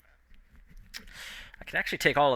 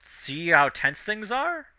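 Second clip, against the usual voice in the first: higher-pitched, slowed disbelief; a little surprised; 6 semitones higher, slower than usual. Acted. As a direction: voice his shock that it is so obvious. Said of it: "This guy is a little surprised".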